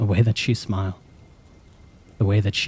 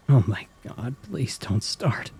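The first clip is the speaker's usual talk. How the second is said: weak begging; 3 semitones higher, 5 dB quieter, slower than usual. A narrator's reading. Narrator begging his own racing mind, weak plea. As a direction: beg weakly, almost breaking. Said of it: "I begged weakly".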